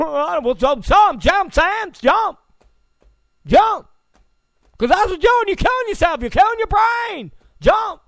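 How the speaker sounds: high, loud stern principal scolding; Joe Clark rant. Retold or imitated. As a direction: yell at the kid to jump off the building. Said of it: "That's what Obama sounded like today".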